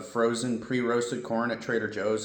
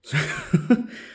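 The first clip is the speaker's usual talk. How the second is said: short, high laugh of disbelief; real puzzled chuckle; baffled laugh; 7 semitones higher than usual. Real, not acted. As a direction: laugh briefly at something that makes no sense. Baffled by the calorie count.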